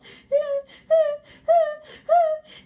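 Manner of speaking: high loud howling wail; wolf wailing cry. Acted. Wolf screaming and crying.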